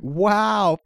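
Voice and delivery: put-on high, slow, wide-eyed toddler voice; toddler imitation. Uncle imitating his nephew spotting a crane, amazed kid voice. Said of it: "he's like".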